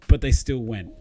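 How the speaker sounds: normal talking voice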